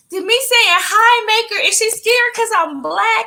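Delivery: high-pitched bewildered questioning; incredulous, high retold reaction; baffled reaction. Retells baffled reaction to girl crying.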